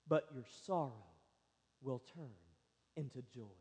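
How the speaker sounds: hushed tender quote. Acted. Soft, slow quote of Jesus.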